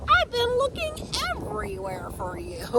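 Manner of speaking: high, eager squirrel. Acted. Squirrel finally finds the sprout, high and eager. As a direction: pipe it up like a squirrel. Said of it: "Squirrel spotted me and said".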